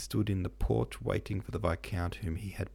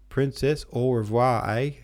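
cried out; 5 semitones higher, 7 dB louder than usual. A narrator's reading. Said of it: "cried he".

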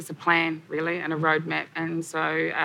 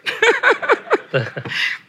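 laughing; 6 dB louder than usual. Real, not acted. Laughing through tears.

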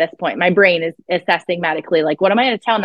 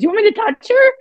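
scared sister asking; 3 dB louder than usual. Retold or imitated. Sister's fearful question.

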